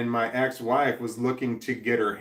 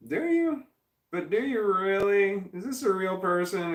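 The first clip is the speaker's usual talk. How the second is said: high, sceptical "do you really?"; incredulous; 9 semitones higher than usual. Real, not acted. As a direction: voice shoots way up in disbelief. Doubting a viewer's compliment.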